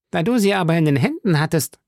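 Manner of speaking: high-pitched musketeer's exclamation; exclaiming. A narrator's reading. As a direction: call it out high, without much added volume. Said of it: "rief Porthos". (hoch ausgerufen, mit polternder Figurenstimme)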